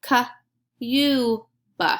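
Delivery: K-U-B as separate sounds; sounding out words. Shown as a demonstration. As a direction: sound out K-U-B one by one. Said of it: "Say it with me".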